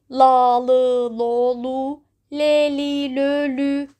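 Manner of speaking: la le lo lu drilled, then le li lo lu; l syllables drill. Shown as a demonstration. Teacher drills the two Turkish l sounds.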